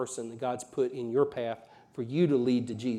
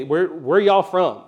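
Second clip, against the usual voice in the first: slow, loud Southern drawl, pitched higher; small-town Southern accent; 4 semitones higher, 8 dB louder, slower than usual. Retold or imitated. Locals back home question the visitor, in an imitated Southern accent. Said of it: "I don't talk like them".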